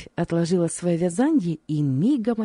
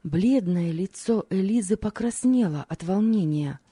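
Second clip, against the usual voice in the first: ordinary delivery, nothing marked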